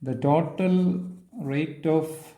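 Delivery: very long pauses between short phrases; slow, piecemeal statement. Real, not acted. Lecturer stating a theorem to students, slowly and piece by piece. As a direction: dictate it slowly, a few words at a time.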